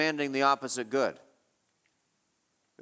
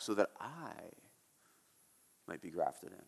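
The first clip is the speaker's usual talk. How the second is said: "I" set apart, lower and much softer; emphatic I; 9 semitones lower, 13 dB quieter than usual. Shown as a demonstration. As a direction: lean on "I" in "so that I might be grafted in". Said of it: "the word I there is emphatic".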